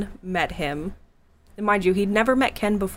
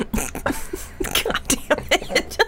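laughing through the words; giggling while cursing; 9 semitones higher, much slower than usual. Real, not acted. Laughing at crude joke.

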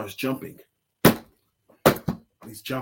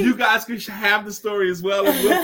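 laughing; 5 semitones higher, 4 dB louder than usual. Real, not acted. Laughs while speaking.

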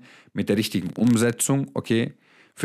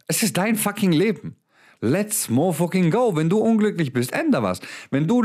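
fired-up push; 6 semitones higher than usual. Real, not acted. Motivational coach firing up his viewers, rising rallying call. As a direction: hype them up.

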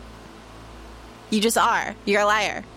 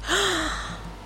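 gasp; 10 semitones higher, 4 dB quieter than usual. Real, not acted. Finds the condoms.

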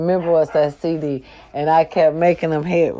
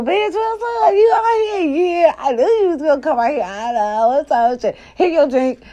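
much higher, squealing shout of joy; excited reunion greeting; 10 semitones higher than usual. Retold or imitated. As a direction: greet a long-lost school friend, overjoyed. Old friends greeting each other.

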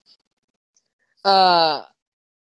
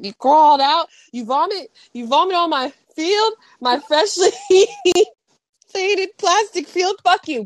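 animated coach rant; 8 semitones higher than usual. Retold or imitated. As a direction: rant wildly at the player. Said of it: "he's so animated".

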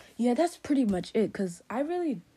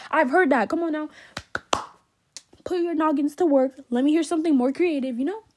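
loud, higher-pitched challenge; fed-up, raised-voice dare; exasperated scolding; 5 semitones higher, 8 dB louder than usual. Real, not acted. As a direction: tell them off loudly, unimpressed. Scolding the unoriginal insulters.

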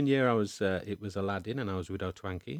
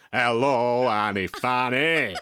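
loud drawn-out call of the crowd; mimicked audience shout; 8 dB louder, much slower than usual. Imitating the children shouting back at the dame, loud and slow. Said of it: "they all just shouted".

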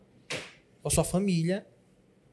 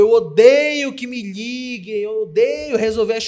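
whiny client voice; 3 semitones higher, 9 dB louder than usual. Retold or imitated. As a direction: drag out the complaint like a fussy client. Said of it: "Ai, Iago".

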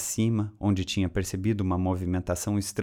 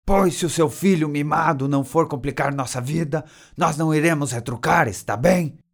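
loud, raised-pitch retort; higher, louder defiant shout; seu Madruga shouting; 7 semitones higher, 5 dB louder than usual. A narrator's reading. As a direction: yell back at someone far away. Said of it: "então gritou em direção ao telão".

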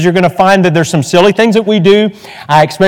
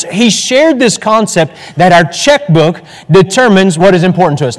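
normal talking voice

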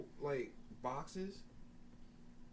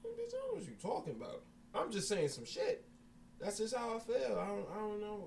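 baffled retort; 9 semitones higher than usual. Retold or imitated. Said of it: "I'm just sitting there like".

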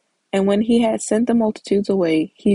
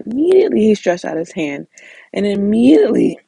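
each immediately punched louder; stress on immediately; 5 dB louder than usual. Shown as a demonstration. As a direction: hammer the word immediately. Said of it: "verses say immediately".

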